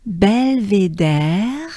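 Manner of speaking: Belvédère stretched out slowly; slow French drill. Shown as a demonstration. Teacher slowly modeling Belvédère. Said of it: "So we go back a little bit".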